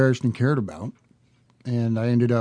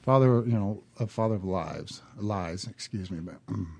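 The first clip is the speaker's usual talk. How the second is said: out of breath; 3 semitones lower, 7 dB quieter than usual. Real, not acted. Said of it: "let me catch my breath here".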